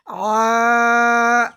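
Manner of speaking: আ from the middle of the throat, pressed and held; throaty ain; pressed ain sound. Shown as a demonstration. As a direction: squeeze the আ from the throat. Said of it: "হলকের মাঝখান থেকে আইন অক্ষর উচ্চারণ করব".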